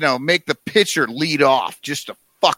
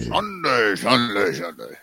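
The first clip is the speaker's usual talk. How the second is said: fading echo; 6 semitones lower, 5 dB quieter than usual. Pretend. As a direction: echo dying away, getting lower and softer.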